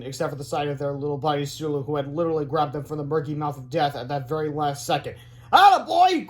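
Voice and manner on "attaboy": high, loud cheer; excited cry. A narrator's reading. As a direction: cheer the hero on. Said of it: "cried George".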